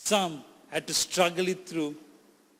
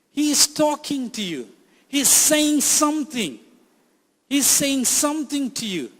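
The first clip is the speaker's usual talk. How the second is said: rising, louder preaching, pressing each repeat; fervent preacher building to a climax; building sermon; 4 semitones higher, 5 dB louder than usual. Acted. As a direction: drive it home to the congregation, growing stronger.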